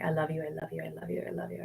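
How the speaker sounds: whispered I love you on repeat; rapid whisper. Shown as a demonstration. Calming herself from anxiety, a repeated soft whisper. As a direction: breathe the words like a secret mantra. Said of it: "Sometimes I'm like".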